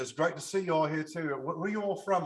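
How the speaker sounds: imitating English politeness, English accent; put-on English accent. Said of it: "I kept losing my, my thing, like my accent".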